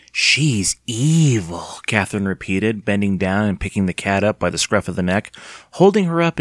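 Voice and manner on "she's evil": slow, long-drawn EVIL; teasing menace. A narrator's reading. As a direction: draw it out slowly like a spooky warning. Aunt repeats her eerie teasing.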